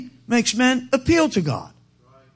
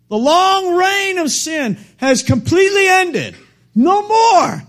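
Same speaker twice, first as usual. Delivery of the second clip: rising, louder and higher preacher's cry building to a peak; full-force preaching; 6 semitones higher, 5 dB louder than usual. Real, not acted. Preacher to congregation about heaven, building to a loud, high cry. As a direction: preach it out to the rafters, climbing with every line.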